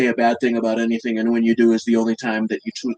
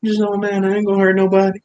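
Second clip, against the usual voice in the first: mock high-pitched plea; 10 semitones higher than usual. Mocking the shady couple, pleading innocence in a high voice. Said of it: "But he's over here like".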